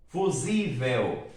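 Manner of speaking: "Fusível" drawn out slowly on the ZI; slow demonstration of "Fusível" as fu-ZI-vel; stress on penultimate syllable. Shown as a demonstration. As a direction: stretch "Fusível", leaning on its middle syllable. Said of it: "Sílaba tônica está aqui, penúltima".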